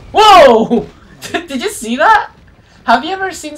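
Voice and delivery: loud amazed laughing shout; excited. Real, not acted. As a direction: blurt it out, thrilled. Amazed by a kill.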